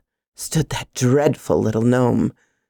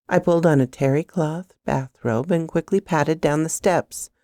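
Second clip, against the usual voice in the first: ordinary delivery, nothing marked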